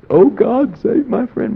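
high, loud pleading cry to God; pleading prayer. Retold or imitated. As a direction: beg God for your friend. Lenny's prayer retold again.

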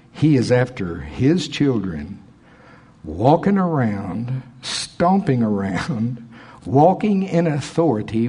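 drawn-out emphatic preaching; slow emphatic preaching. Acted. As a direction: lean on each phrase slowly. Preacher slows into emphatic build.

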